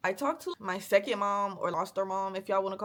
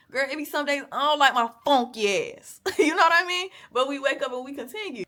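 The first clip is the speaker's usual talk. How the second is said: louder, laughing through it; laughing self-roast; 5 semitones higher, 8 dB louder than usual. Real, not acted. Vlogger joking to viewers about herself, laughing.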